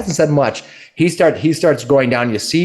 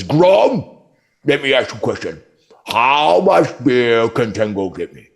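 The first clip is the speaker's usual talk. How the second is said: ogre voice. Acted. Ogre asks other ogre.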